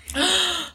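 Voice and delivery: short, high intake of breath; sharp gasp. Real, not acted. Telling listeners about just washing her hair, sharp gasp. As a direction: gasp mid-story.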